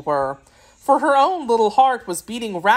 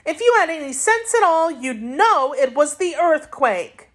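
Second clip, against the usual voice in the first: put-on higher girl voice, slowing to scold; a child lecturing an adult, mildly cross; indignant girl; 8 semitones higher than usual. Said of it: "answered Dorothy indignantly".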